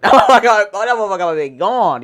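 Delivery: loud laughing repeat of the line. Real, not acted.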